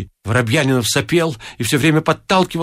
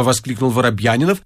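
ordinary delivery, nothing marked

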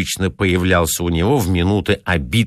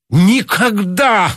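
much higher, loud, slow ardent cry; 13 semitones higher, 5 dB louder, much slower than usual. A narrator's reading. Pedant refusing to concede to the director, ardent loud cry. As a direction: fling it out passionately. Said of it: "пылко сказал Гарунский".

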